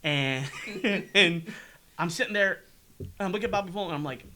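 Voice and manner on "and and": ordinary talk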